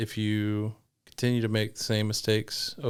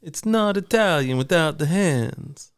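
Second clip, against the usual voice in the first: put-on Italian accent; 7 semitones higher, 5 dB louder, slower than usual. Answers in Italian voice.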